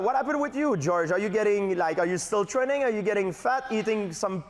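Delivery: high-pitched mock interviewer; Michael Landsberg. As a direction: pepper the guest with questions in an imitated host voice, high. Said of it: "you go back to being George".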